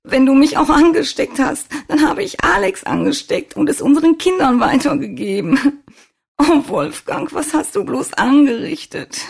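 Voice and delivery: high-pitched, anguished wailing; loud sobbing. A narrator's reading. Svenja fearing she infected her family, sobbing loudly. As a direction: sob it out loudly, close to hysterical. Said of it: "schluchzte laut auf".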